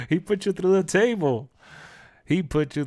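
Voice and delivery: real laughter while repeating; laughing. Real, not acted.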